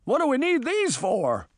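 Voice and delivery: high-pitched anxious query; alarmed question. A narrator's reading. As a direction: ask it with worry, voice jumping up. Nervous character questions the life jackets.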